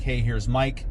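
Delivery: ordinary delivery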